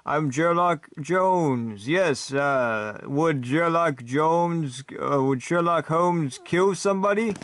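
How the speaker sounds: very slow, drawled mock American accent. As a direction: keep up the fake American accent, stalling over the names. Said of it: "you have to do an American accent".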